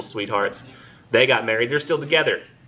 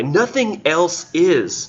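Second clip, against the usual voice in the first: high, very slow theatrical recital; Nothing else is, drawn out high and slow; weird reading voice; 7 semitones higher, much slower than usual. Shown as a demonstration. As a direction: stretch it out grandly. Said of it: "read this with this weird voice".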